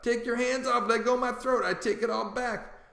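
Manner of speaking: high, choked plea to let go; king yelps to Duke to free his throat, high cry. A narrator's reading. King begs Duke to release his throat, high cry. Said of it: "The king sings out".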